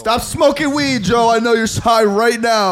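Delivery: loud, high, drawn-out telling-off; mock scolding. Teasing Joe about being high, loud mock scolding. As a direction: call him out in a slow mock yell.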